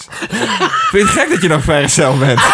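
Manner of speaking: high, laughing disbelief; cracking up in disbelief; laughing exasperated. Real, not acted. Exasperated laughing outburst at Gordon. (lachend en ongelovig)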